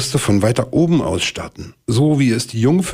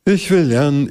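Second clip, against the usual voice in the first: saying aloud; 8 semitones higher than usual. Acted. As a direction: announce the grand idea to the room. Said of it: "sagte laut".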